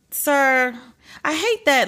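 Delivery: high, fed-up groan of disapproval; cringing at a fake apology; exasperated. Real, not acted. Host reacting to a non-apology, exasperated.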